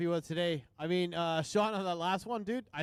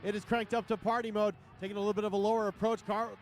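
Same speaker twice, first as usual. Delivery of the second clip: high, building announcer call; dueling hype announcers; 5 semitones higher than usual. Acted. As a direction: hype up the crowd as the drivers finish. Live drift commentary, crowd call.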